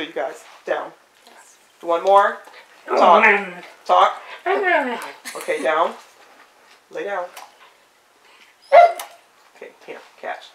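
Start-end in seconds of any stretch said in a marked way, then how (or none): 0.7-7.2 firm commands to a dog; talking to a dog. Real, not acted. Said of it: "I'm talking to the dog". 9.6-10.4 whispered praise to a dog; talking to a dog. Real, not acted. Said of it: "I'm talking to the dog".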